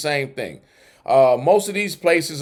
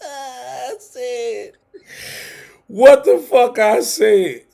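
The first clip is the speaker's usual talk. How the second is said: helpless high laughter; cracking up mid-sentence; laughing; 7 semitones higher, much slower than usual. Real, not acted. Cracking up at own line.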